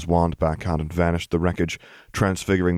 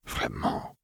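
soft, near-whispered French pout; hushed, mildly sulky murmur; 8 dB quieter than usual. A narrator's reading. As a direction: say it small and sulky. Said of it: "she pouted".

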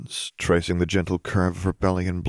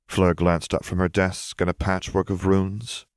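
ordinary delivery, nothing marked

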